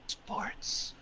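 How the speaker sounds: imitating a stoned friend's faint whisper; barely-there whisper with a gap between words; strained whisper. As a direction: whisper it slowly, word by word. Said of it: "And he whispers it again".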